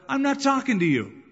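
loud, high, defiant outburst; defiant retort. Retold or imitated. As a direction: push back hard at the crowd. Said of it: "he probably thought".

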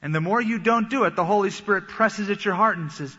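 normal talking voice

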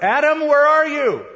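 high, loud call; God calling for Adam in the garden, loud and high; crying out. Retold or imitated. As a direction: call out for someone hidden. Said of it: "God cried out".

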